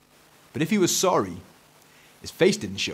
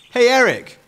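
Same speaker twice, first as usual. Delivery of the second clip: high, loud hail; calling out; 13 semitones higher, 10 dB louder than usual. A narrator's reading. Dad hails his son, calling out. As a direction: call out as Dad across the garden. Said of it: "called Dad".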